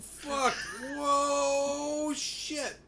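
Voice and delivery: high startled yelps at a crash; real alarm, voice jumping up; shocked cry. Real, not acted. Truck crash in game.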